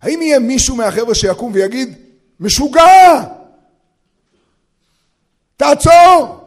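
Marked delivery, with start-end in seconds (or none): 2.4-6.4 high, loud yell to stop; alarmed yell. Acted. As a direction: cry out to halt a madman. Someone rises and yells out. (צעקת אזהרה גבוהה וחזקה)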